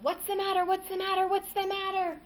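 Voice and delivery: jolted awake and shouting; father jolted awake, yelling at his son; startled yell. A narrator's reading. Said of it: "jumped out of bed and yelled".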